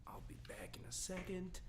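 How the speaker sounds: whispered aside while stepping away. Real, not acted. Co-host steps away to put the dog down, whispering. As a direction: drop to a breathy whisper before leaving.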